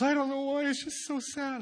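put-on high, weepy girl's voice; little sister crying. Pastor imitating his tearful twelve-year-old sister, high and weepy. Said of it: "She goes".

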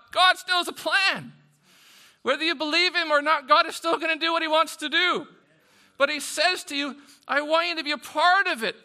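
loud, high, driving sermon; full-force preaching. Real, not acted. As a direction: preach it to the back row.

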